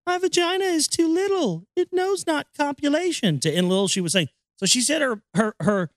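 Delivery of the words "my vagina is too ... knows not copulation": higher put-on maiden voice; prissy falsetto maiden; hammed-up maiden voice, higher; mock girl voice. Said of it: "She says".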